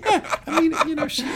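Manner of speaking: breaks into high laughter through the words; can't stop laughing while agreeing. Real, not acted. Laughing with the co-host at the joke, high and loud.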